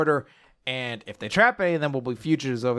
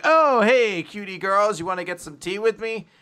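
flirty act; 5 semitones higher than usual. Retold or imitated. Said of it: "Finral starts doing his whole act".

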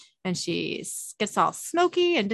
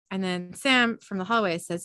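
ordinary talk

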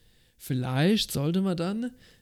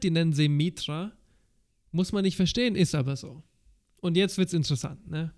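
ordinary delivery

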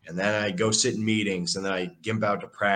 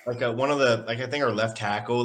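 normal talking voice

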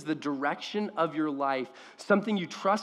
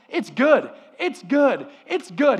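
higher and louder, beaming delight; delighted declaration; 8 semitones higher, 8 dB louder than usual. Retold or imitated. God declaring creation good, louder and delighted. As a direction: proclaim it with joy. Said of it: "his declaration after everything he points out is".

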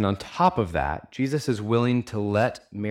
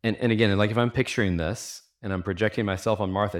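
ordinary talk, nothing marked

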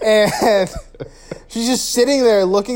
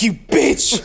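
hammed-up indignant cry; mock outrage; 2 semitones higher than usual. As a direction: accuse in pretend fury. Acts killer discovering the trick.

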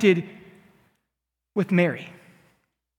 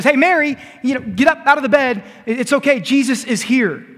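higher, louder call to her sister; 6 semitones higher, 3 dB louder than usual. Acted. Martha calling to her grieving sister, loud and urgent. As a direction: rouse her from bed.

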